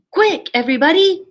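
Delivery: rally the crowd with a quick shout. Acted. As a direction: hero dog calling the animals to act. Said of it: "Dex shouted".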